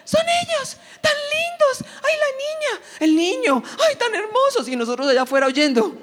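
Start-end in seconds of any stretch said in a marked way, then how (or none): 0.0-4.7 much higher, gushing shrieks of delight; excited squeals. Retold or imitated. Relatives in the next room squealing at first sight of the kids. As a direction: squeal over the children like thrilled relatives. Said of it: "comienzan a gritar".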